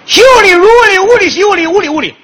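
high, loud showoff chant; loud proud recitation. Pretend. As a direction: declaim it proudly at full voice. 高声念胡诌下联. (得意洋洋高声朗诵)

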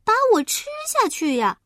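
high, loud shocked little pig; a piglet realizing the danger. Acted. Piglet asks the wolf, high and shocked.

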